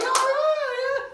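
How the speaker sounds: high, loud mock squeal; overjoyed mom imitation. Girl's mom cheering the pregnancy news, high excited squeal. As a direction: imitate a thrilled mother squealing.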